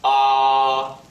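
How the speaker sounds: long "ah" held level; high flat "ah"; first tone. Shown as a demonstration. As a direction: sustain "ah" without moving the pitch. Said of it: "high and level pitch".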